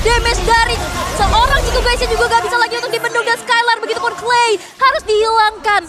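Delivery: loud, high hype call; excited shout. Real, not acted. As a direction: yell the play as it lands. Caster hypes a big teamfight. (teriakan caster yang heboh)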